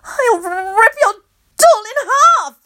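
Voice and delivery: high shrieking threat; shrieked threat to tear the doll; screaming. A narrator's reading. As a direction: scream it out in a rage. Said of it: "Loretta screams".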